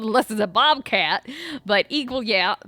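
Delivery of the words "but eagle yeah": normal talking voice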